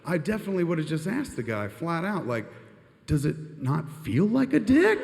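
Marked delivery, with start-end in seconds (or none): 3.1-5.0 slow, high, mocking question; mock incredulous. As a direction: ask it like it's obvious. Mock guard question in bit.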